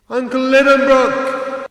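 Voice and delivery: high, slow call into the dark. A narrator's reading. Axel calls into the darkness for his uncle, slow and high. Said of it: "I called out".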